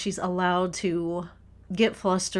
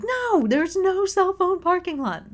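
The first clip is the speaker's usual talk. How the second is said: high, louder exasperated yell; frustrated shout; 12 semitones higher, 4 dB louder than usual. Retold or imitated. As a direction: snap back at husband in full frustration. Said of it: "My voice is raising".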